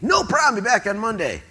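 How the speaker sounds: high, loud, overconfident; young guy promising the boss he'll raise the money, eager; eager promise. Retold or imitated. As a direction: promise it like nothing could stop you.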